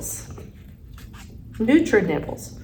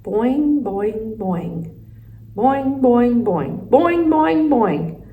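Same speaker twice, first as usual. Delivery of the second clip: bouncy boings, building louder and higher; each round more insistent, falling on the last; frog croaks; 4 semitones higher, 4 dB louder, much slower than usual. A narrator's reading. Frog makes noise to help hamster, booming boings.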